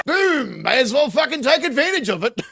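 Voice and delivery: loud, laughing boom; laughing shout. Real, not acted. Commentating the grab, loud and laughing. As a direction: whoop it out, laughing.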